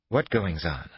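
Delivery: ordinary delivery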